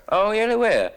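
high-pitched show of interest; mock interest. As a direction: feign keen interest, pitch dropping on the last word. Comic acts polite interest in couple.

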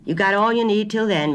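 mildly self-satisfied grocer. A narrator's reading.

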